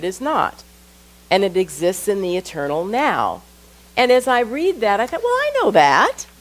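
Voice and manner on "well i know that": high, louder dismissive retort; pitched-up, exaggerated self-mockery; mock know-it-all. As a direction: act out brushing it off as old news. Acts out own dismissive first reaction.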